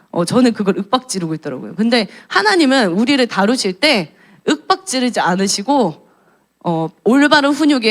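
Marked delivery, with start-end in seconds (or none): none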